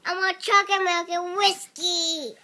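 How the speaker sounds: normal talking voice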